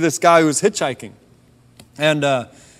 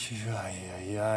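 low, much softer grudging mumble of yeahs; grumpy; 8 semitones lower, 16 dB quieter, much slower than usual. Retold or imitated. Driver to God, grudgingly agreeing to drive on. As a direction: give in with a reluctant, sulky mumble. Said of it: "again, grumpy".

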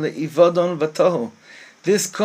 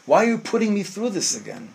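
higher-pitched plaintive protest; anguished questioning; 4 semitones higher, faster than usual. Acted. Man challenging God like the angels, plaintive protest. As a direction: plead upward, worn down by it all. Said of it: "that these angels are saying".

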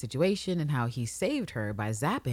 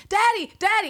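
put-on high, loud childish cry for daddy; much higher, louder mock child scream; kids screaming; 6 dB louder than usual. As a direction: yell like scared little kids. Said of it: "the kids start yelling and screaming".

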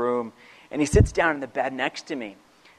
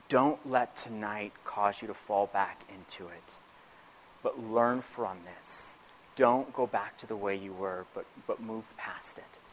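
much softer, slow and gentle; tender father; 4 semitones lower, 14 dB quieter, slower than usual. Retold or imitated. Father sitting on son's bed, softly and slowly proud. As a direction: say it like a dad quietly opening up.

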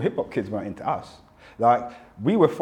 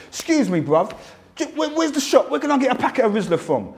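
high-pitched London street query; raised, urgent London voice; acted London request; 11 semitones higher than usual. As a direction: ask a passer-by in broad London slang. Acting himself asking in own London accent.

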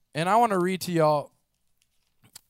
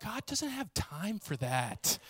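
mock scoffing; 11 dB quieter, slower than usual. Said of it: "I'm like".

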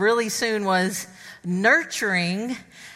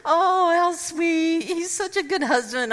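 high, slow, gushing coo; gushing assistant; 6 semitones higher than usual. Retold or imitated. Assistant gushes over the husband, cooing. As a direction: coo over how sweet he is. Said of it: "she goes".

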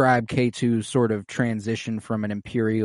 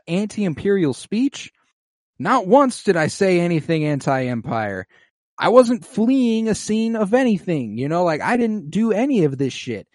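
high, louder mock indignation; indignant protest; 8 semitones higher, 5 dB louder than usual. Defendant disputes the charges before the judge, indignant. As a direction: protest each charge with voice pitched up.

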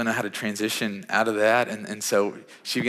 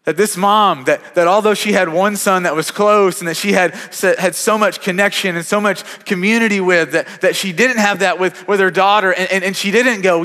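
preaching fervor; 7 semitones higher, 6 dB louder than usual. Real, not acted. Preacher builds to the point.

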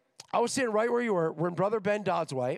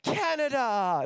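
shouted cheer, pitched up; mock Canadian cheer; 7 semitones higher, 6 dB louder, slower than usual. Canadian student standing up to claim Canada, loud proud cheer. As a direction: belt out the country name like a proud fan. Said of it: "They're like".

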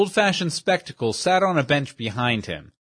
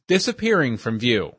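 usual speaking voice

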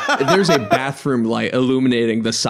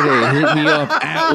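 laughing. Real, not acted. Laughing through a plug.

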